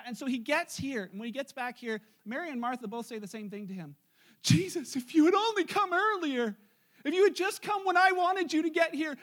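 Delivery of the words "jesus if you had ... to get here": higher, louder pleading complaint; acted reproach. Grieving sisters confronting Jesus on his late arrival, in acted reproach. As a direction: plead as if he came too late.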